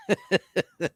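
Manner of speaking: quick, high, amused delivery with real laughter; laughing. Real, not acted. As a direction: talk through a real laugh. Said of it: "you gotta laugh".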